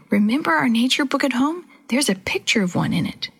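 ordinary delivery

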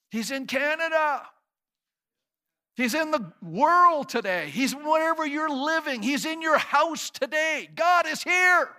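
fervent revival preacher; preaching at full force. Acted. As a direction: climb each line to the peak. Preaching crescendo.

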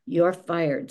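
ordinary delivery

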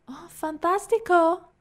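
high, loud, drawn-out delight; effusive waiter. A narrator's reading. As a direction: trill it in the effete waiter's voice. Effusive waiter takes the order.

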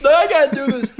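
high-pitched panicky imitation; mock panic. Teasing a character who panics and backs away, mock panic. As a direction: whine out a hammed-up refusal. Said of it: "a bit of a panic moonwalker".

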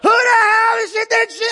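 very high outraged yell; mock rant on someone's behalf; going in angry. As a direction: explode in hammed-up fury, voice shooting up. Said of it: "I'm going in".

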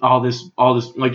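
normal talking voice

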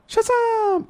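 sudden high shout of the hero's name; shouted magic word. Retold or imitated. Kid yelling the magic word to turn into a hero. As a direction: bellow it out to transform. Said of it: "Shazam grita".

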